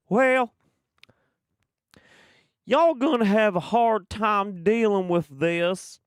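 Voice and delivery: drawling cowboy. Retold or imitated. Voicing the game hero's taunt, hammed-up Southern drawl. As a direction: ham up a smug Southern drawl. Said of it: "And he's like".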